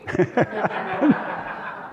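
a soft, airy unvoiced chuckle; breathy laugh. Real, not acted. Pastor laughing at himself after teasing the congregation, airy chuckle. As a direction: laugh quietly at his own joke.

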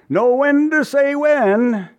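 high, slow sing-song mockery of the advice; mock the easy motto in a lilting voice. Mocking worldly advice.

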